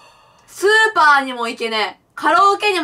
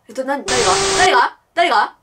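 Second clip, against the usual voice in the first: usual speaking voice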